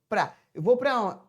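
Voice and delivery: ordinary talk, nothing marked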